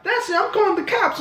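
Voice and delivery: high mock-woman threat; hammed-up, high-pitched ultimatum; imitated Karen threatening. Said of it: "And she's like".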